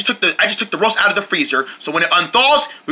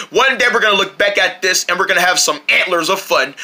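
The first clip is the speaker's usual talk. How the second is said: normal talking voice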